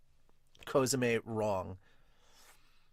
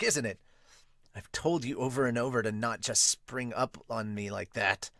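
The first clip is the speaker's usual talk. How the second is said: usual speaking voice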